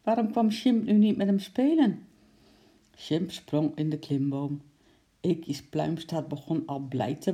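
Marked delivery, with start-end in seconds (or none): 0.0-2.1 whiny little squirrel. A narrator's reading. Squirrel wonders why Sjimp won't play.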